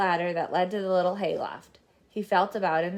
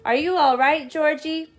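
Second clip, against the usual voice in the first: calling out; 6 semitones higher, 4 dB louder than usual. Acted. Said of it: "called out".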